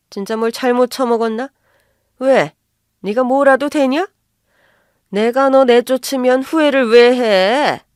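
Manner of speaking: outraged mother-in-law scoffing at a threat; angry scorn. Acted. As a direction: throw the threat back at them, loudly.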